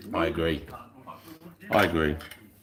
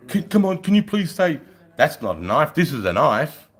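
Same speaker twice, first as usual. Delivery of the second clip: eager American fan; 6 semitones higher, 6 dB louder than usual. Retold or imitated. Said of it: "Yanks come up to me and say".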